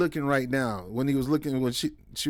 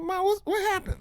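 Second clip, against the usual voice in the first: put-on worried child voice. Boy asking his crying mother what happened, in a high, small voice. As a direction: sing it up high like a scared kid.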